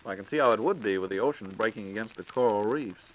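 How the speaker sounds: ordinary delivery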